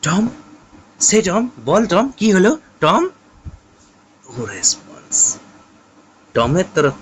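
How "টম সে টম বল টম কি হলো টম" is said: Sid calling out. Acted. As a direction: call a brother who won't wake. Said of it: "সিড তখন বলছে".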